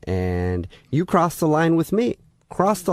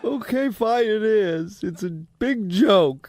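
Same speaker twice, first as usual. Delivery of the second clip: crying on the phone; 8 semitones higher, slower than usual. Acted. Fake coach keeps acting hurt.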